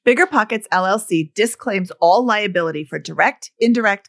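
loud rapid legal read; fast legal disclaimer. Acted. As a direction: rush through the fine print.

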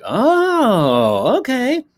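high drawn-out oh, then a sharp rising okay; sudden realization from the crew; high surprised oh, okay; dawning surprise. Retold or imitated.

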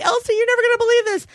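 high, fast, thrilled reveal; excited announcement. Retold or imitated. To Elsie, showing off the ice tray, high and fast. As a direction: blurt the big news, bursting with glee. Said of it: "I'm like".

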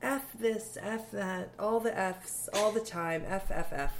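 softly mimicked motherly complaint; understated imitation of mom's reproach; imitated disapproving mother. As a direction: list the offences in a mild, hushed voice. Imitating mother's complaint about swearing.

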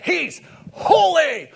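high, booming drawn-out shout; a slow, very loud proclamation; emphatic exclamation. Shown as a demonstration. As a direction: cry it out with ten exclamation points. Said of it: "exclamation point behind holy or, or ten".

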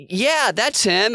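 high, slow eager yes; mock caller. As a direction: voice the caller brightly agreeing. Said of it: "And she's like".

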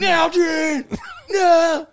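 high, loud movie-castaway wail; mock heartbroken scream. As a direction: cry out melodramatically for something lost at sea. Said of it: "like fucking, uh, Tom Hanks with fucking Wilson".

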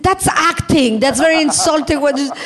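high-pitched indignant retort; hammed-up outrage; mock offended. Acted argument with her husband.

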